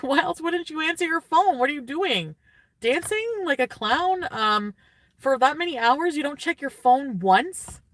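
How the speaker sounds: worked-up rant. Real, not acted. Ranting to viewers about him ignoring calls, exasperated and loud. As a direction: vent it, rising in disbelief.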